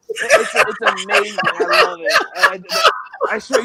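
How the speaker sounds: cracking up at his own impression; real laughter, squeaking high; laughing. Real, not acted.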